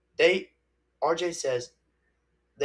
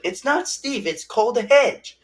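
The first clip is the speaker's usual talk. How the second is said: higher, louder insistence; mock correction; 8 semitones higher, 7 dB louder than usual. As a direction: set them straight loudly. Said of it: "I'm like".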